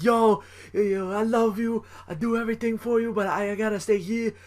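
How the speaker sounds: high-pitched mock wrestler impression; Homicide. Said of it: "C M Punk doing his homic-, best Homicide impression".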